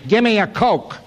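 loud, high call across the room; brash American order. Retold or imitated. Calling the waiter in England like a loud American, loud and high. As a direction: bark the order like a brash Yank tourist. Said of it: "you get better service in England if you say".

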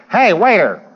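hammed-up loudmouth Yank hailing a waiter; brash American order. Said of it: "if you say".

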